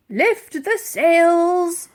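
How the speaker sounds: slow, loud officer command; admiral's command. Acted. The Admiral bellowing the order to his crew. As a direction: give the order like a ship's captain.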